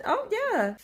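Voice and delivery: smug chef. Retold or imitated. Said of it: "you said".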